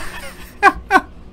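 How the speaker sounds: high, breath-only burst of laughter; helpless laugh. Real, not acted. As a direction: crack up at the silly sight. Laughs at glitching character.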